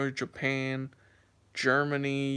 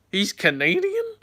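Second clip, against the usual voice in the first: drawn-out, higher and louder disbelieving question; shocked disbelief; 6 semitones higher, 7 dB louder than usual. Retold or imitated. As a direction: act out stunned disbelief at the news. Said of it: "and I was like".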